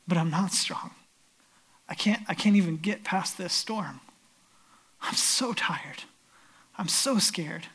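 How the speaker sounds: low, hushed admission of weakness; softly, almost whispering, as if drained; weary confession. Acted. Exhausted disciple answers Jesus in the storm, hushed confession.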